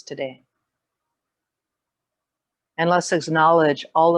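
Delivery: measured meditation voice with long pauses; guided meditation. Real, not acted. Yoga teacher leading the class into grounding and gratitude, guided meditation. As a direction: settle them into presence, phrase by phrase, unhurried.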